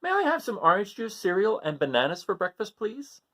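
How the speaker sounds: put-on high polite child voice; Gregory asking politely. Gregory asking his parents for breakfast, high and polite. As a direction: ask sweetly like a well-mannered kid. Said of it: "said Gregory".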